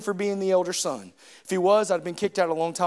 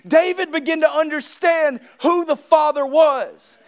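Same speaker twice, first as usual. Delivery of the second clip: loud, raised preaching to the congregation; full-force preaching; 7 semitones higher, 7 dB louder than usual. Acted. Pastor urging his church on prayer, preaching loudly. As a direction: drive it home from the pulpit.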